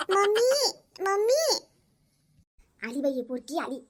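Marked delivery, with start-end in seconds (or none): 0.1-1.6 put-on high, loud child's voice calling mummy with a laugh; a little girl laughing as she calls for her mother. Little girl runs in calling her mummy, high and laughing.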